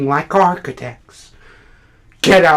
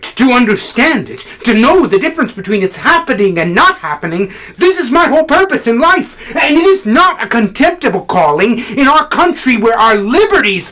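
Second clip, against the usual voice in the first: higher-pitched, driving declaration; impassioned tirade; 9 semitones higher, 5 dB louder than usual. Acted. Critic defending his whole purpose in life, impassioned. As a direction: build into a fervent, ringing argument. Monologue builds to impassioned outburst.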